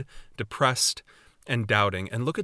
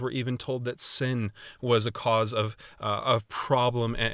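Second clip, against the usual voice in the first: usual speaking voice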